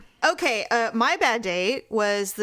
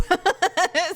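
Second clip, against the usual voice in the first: laughing; 8 semitones higher than usual. Real, not acted. Laughing at own story.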